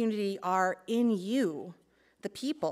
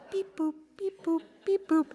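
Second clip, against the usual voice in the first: high, silly beep boop; playful beep boop; 8 semitones higher than usual. Shown as a demonstration. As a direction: chirp it like a tiny robot.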